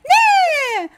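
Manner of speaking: very high, loud shriek; leprechaun shriek. Acted. Leprechaun screeches at the group on his board. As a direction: scream it as he rides by. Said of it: "Leppy goes by".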